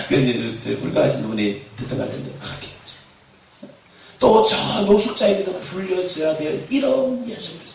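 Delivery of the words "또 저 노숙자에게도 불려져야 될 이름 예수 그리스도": loud, slow chanted call; slow preaching call. Shown as a demonstration. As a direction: lead the congregation's response. (천천히 크게 외치는 선창)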